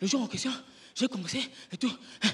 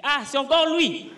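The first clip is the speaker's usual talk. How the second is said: annoyed heckler; 8 semitones higher, 8 dB louder, slower than usual. Retold or imitated. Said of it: "il dit".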